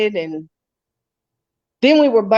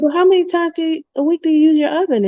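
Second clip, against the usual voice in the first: quick question; 9 semitones higher, much faster than usual. Retold or imitated. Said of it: "He says".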